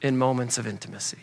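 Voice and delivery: gentle voice of love. Retold or imitated. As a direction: let love speak quietly and selflessly. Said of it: "But love would say".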